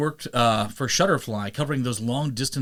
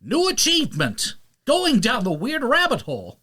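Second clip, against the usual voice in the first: high, hammed-up game announcer; 11 semitones higher than usual. Podcaster mimics the game's achievement announcer, high and hammy. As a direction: call it out like a game pop-up.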